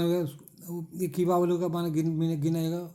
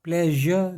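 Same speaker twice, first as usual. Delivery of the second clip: Pleasure broken into two stretched beats, ple-zhar; syllable split; 5 dB louder, slower than usual. Shown as a demonstration. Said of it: "कहाँ का syllable तोड़ा है?".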